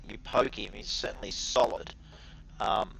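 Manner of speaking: ordinary talk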